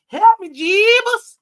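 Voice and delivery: loud, high mocking plea; hammed-up "Help me, Jeebus" call; mock cry for help. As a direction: ham up a god crying for help. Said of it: "cried out".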